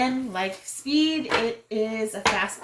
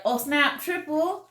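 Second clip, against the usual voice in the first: high, excited game call; 6 semitones higher, slower than usual. Shown as a demonstration. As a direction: shout out the triple in surprise. Acting out calling a triple.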